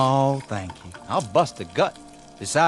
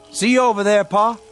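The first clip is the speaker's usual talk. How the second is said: loud, raised-pitch farewell call; 8 semitones higher, 7 dB louder, faster than usual. Acted. Son leaving for the fields, calling out to Pa. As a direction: call it across to your father.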